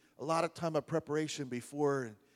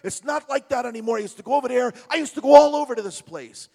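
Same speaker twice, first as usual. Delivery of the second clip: put-on Brooklyn accent; 11 semitones higher, 11 dB louder than usual. Retold Brooklyn guy reminiscing.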